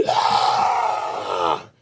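imitated kid's tantrum wail; drawn-out yell mimicking a child throwing a fit; mock tantrum scream. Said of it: "this kid threw a berrinche".

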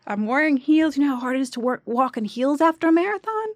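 high, loud mock exasperation; acted-out indignant complaint; exasperated rant. Angry audition rant, acted out.

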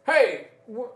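high-pitched shout after someone; calling out. Acted. Man calls after someone running past, startled shout. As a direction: call after a runner, stumbling on the words. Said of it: "there is a man nearby shouting".

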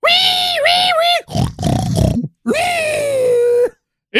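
piercing high pig squeals; hog squeal. Acted. History Hog is released, squealing.

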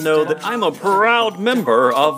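upbeat podcast ad voice; ad read. Acted. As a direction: sell it like a cheesy advert. Recorded merch ad read.

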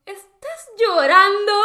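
high, slow, disbelieving question; surprised gasp question. Retold or imitated. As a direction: stretch it out in shock. Sorpresa al ver llorar a Claudia. (sorpresa exagerada, aguda y lenta)

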